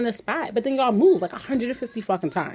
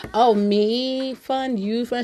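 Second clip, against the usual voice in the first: put-on slow, high-pitched Spanish-accented broken English; slow mock Spanish accent in broken English; imitating a Spanish accent; 4 semitones higher, much slower than usual. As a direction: mimic her halting Spanish accent, drawing each word out. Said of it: "thick-ass Spanish accent".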